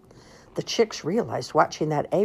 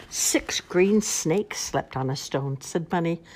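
usual speaking voice

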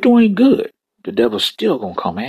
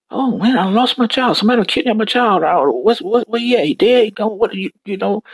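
panicked mother; 11 semitones higher than usual. Acted. Mary voiced in retold story.

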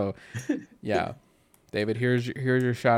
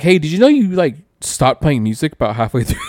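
imitating the friend, higher and louder; mock-alarmed friend; 4 semitones higher, 9 dB louder than usual. Retelling how Daniel texted about an editing mistake, mock-alarmed and loud. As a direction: play up the friend's shocked call-out.

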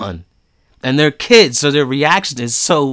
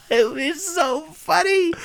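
high, drawn-out squeal of laughter; helpless real laughter; cracking up; slower than usual. Real, not acted. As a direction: squeeze the words out while laughing. Cracking up at the memory.

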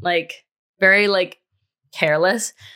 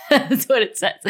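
laughing; 6 semitones higher than usual. Real, not acted. Laughing at her own outline.

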